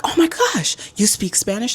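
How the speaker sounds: high, loud imitated gushing; mock excitement. Roommate hearing her speak Spanish, hammed-up amazement.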